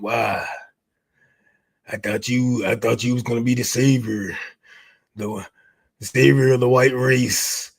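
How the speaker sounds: mock sulking fan. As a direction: drag it low like a whiny, beaten-down fan.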